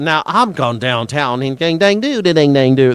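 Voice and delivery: mocking, exaggerated Virginia twang; real country Virginia accent. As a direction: imitate the in-laws' silly country Virginia accent. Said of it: "shitty, stupid Virginia accent that was, like, real country".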